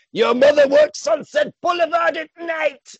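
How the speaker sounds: high, loud shouted insult; hammed-up yelled taunt at the villain; mock insult shout. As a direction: bellow the insult in a put-on voice. Chinese line translated as insult.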